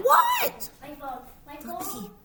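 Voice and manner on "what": very high squealed What; Kitty yelling at Unicorn. Acted. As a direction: squeal the word in shock. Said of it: "yells Kitty".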